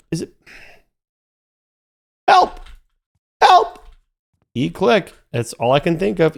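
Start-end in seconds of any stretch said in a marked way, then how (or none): 2.3-3.9 high, loud playful cry of "Help!"; mock cry for help. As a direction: call out for help as if stranded, half joking. Stuck in game, calling for help.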